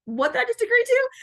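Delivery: high-pitched acted disbelief at yourself; startled double take. Parent realizing what she agreed to, mock startled. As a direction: catch yourself, pitch shooting up on the question.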